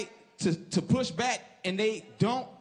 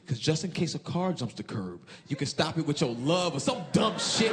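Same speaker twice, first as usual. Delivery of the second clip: dad's advice; 8 semitones lower than usual. Retold or imitated. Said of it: "my dad always said".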